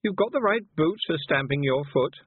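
put-on haughty bossy girl. A narrator's reading.

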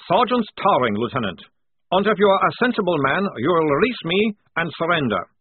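confident captive stating his terms; captured sergeant. A narrator's reading. Prisoner advising a lieutenant to surrender, confident and accented.

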